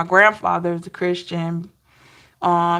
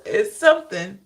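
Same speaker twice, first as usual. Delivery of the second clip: ordinary talk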